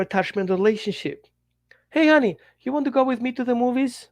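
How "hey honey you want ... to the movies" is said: high, quick, eager sweet-talk; playfully acted lovey-dovey asking; sweet invitation. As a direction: invite your honey out in a lifted voice. Voices an affectionate invitation to partner.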